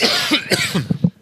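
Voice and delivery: sudden hard coughing; coughing fit. Real, not acted. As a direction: heftiger Hustenreiz, kurz und hoch. Hustenanfall mitten im Gespräch.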